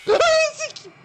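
piercing very high, very loud shriek; full-throat scream at the bear. Real, not acted. Bear attack in horror game. (tiz, avazı çıktığı kadar çığlık)

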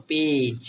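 পেজ with a long drawn ei vowel; A pronounced ei. Shown as a demonstration. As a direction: stretch পেজ slowly as peij. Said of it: "তাহলে উচ্চারণ কার মতো হবে?". (পেজ টেনে টেনে উচ্চারণ)

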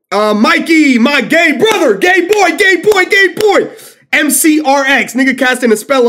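high loud hype shout; excited shout. Real, not acted. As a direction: yell it hyped. Hyped calling out.

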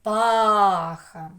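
ЧЕРЕПАХА slow, with ПА loud and long; stress on ПА. Shown as a demonstration. Teacher repeating where the stress falls, loud drawn-out ПА. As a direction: lean hard on the ПА of ЧЕРЕПАХА. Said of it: "Куди сила голосу?".

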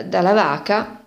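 ordinary delivery

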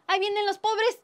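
loud, high-pitched warning cry; alarmed shout. Acted. Rich diner warning the others of the poor, alarmed shouting. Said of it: "cuando uno de ellos gritó".

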